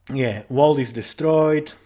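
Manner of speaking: ordinary talk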